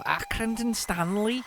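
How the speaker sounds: mock Scouse kid from the advert; Scouse accent. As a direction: put on an exaggerated Scouse accent. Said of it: "Scouse accent there".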